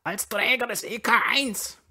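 very high, loud croaked boast; croaking. A narrator's reading. Brüller flaunts his medal to the landlord, croaking. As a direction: croak it out, pulling rank. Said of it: "krächzte der Brüller".

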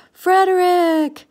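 high, loud, drawn-out cry of recognition; joyful storybook shout; cried out. A narrator's reading. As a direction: call out the long-lost friend's name. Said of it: "cried Jonathan".

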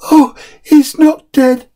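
put-on a little boy glad his dog lives; relieved small boy. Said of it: "said the small boy".

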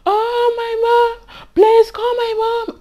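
put-on high, loud, drawn-out wail of a confused old woman; slow, high desperate plea; panicked elderly resident. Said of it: "what I saw was like".

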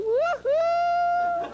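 high loud whoop; excited whoop. Acted. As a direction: whoop with delight. Dummy cheers showgirl.

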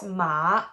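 "ma" dipping down, then rising; long dipping-tone "ma"; third tone. Shown as a demonstration. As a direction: draw "ma" down and back up. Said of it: "It goes down and up".

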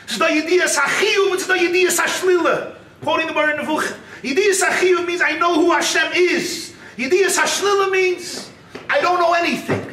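high, emphatic teaching voice; impassioned preaching. Acted.

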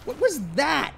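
high startled question; shocked. Acted. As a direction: react in alarm. Partner shocked by stomping.

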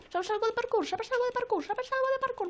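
high, repeated taunt; high-pitched cheeky chant; excited taunting. Real, not acted. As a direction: tease the chaser in a high voice. Taunting a pursuer while escaping.

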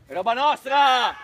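loud call, pitched a little low; claiming shout. Real, not acted. Player claims the ball for his team, shouting.

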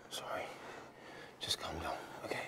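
very soft, calming voice; gentle, quiet comforting; hushed reassurance. Acted. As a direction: soothe them down to near-whisper. Reassures her not to be frightened.

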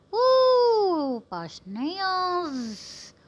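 high whooping woo; excited cheer. Real, not acted. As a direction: let out a thrilled woo. Cheering at the couple. (masayang sigaw ng woo)

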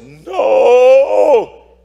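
put-on high, loud stubborn refusal in a boy's voice; defiant boy. Priest retelling the boy's refusal, high defiant boy voice. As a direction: snap back like a scared teenager.

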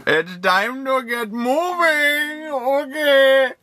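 slow, high sing-song good morning; drawn-out wake-up voice; sing-song wake-up call. Acted. Waking partner in the morning.

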